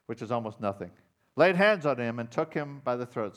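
loud, high fervent preaching; preaching at full force. Real, not acted. Pastor retelling parable to congregation, loud fervent preaching. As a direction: drive the story home loudly.

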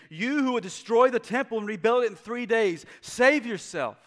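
loud mocking jeer; mocking passerby. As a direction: throw the taunt up at him, sneering. Mockers voiced in scripture reading.

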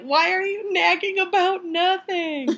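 high, slow mock exasperation; exasperated retort. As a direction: throw it back, fed up and a bit whiny. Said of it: "And I'm like".

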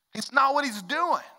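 ordinary delivery

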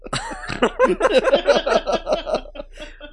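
long loud laugh; laughing through pain. Real, not acted. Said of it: "it hurts to laugh".

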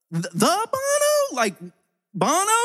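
very high, drawn-out incredulous question; hammed-up astonishment, voice shooting way up; stunned disbelief. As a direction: squeak it out in starstruck shock. Acting out his stunned reaction.

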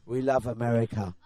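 low mock American accent; put-on American accent. English guest repeating his answer, put-on American accent. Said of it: "their accent go away".

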